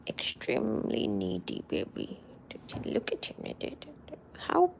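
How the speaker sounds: soft whisper over the cat. Real, not acted. Owner cooing over her needy cat, whispered.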